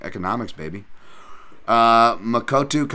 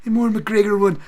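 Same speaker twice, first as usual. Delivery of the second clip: higher, louder put-on Irish accent; McGregor; 11 semitones higher, 6 dB louder than usual. Streamer showing off his other version, mock Irish accent. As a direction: lay on a mock Irish lilt. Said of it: "I can always do the".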